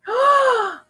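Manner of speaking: sharp high gasp of realization; a delighted startled gasp. Acted. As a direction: suddenly get it.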